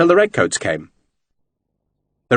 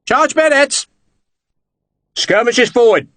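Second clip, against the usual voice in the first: shouted commands; 11 semitones higher than usual. A narrator's reading. Officer shouting orders to advance on the field. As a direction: drive the men forward with it.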